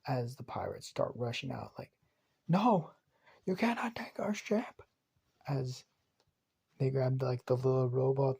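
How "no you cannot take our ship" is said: high, loud cry of protest; pirate shouting. Acted. Pirates yelling after the stolen ship, high and loud. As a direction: yell it as the ship pulls away.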